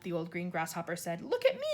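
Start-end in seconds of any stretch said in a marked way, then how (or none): 1.2-1.7 high gleeful cry; the centipede crows about his freedom; excited cry. A narrator's reading. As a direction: crow it out happily. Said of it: "cried the centipede".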